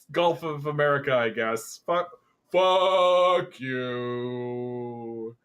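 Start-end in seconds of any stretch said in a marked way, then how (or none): 1.9-5.4 long, drawn-out curse; jeering, held on one note; exasperated cursing. Real, not acted. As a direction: stretch it out like a sustained boo. Mock-cursing the compromise naming.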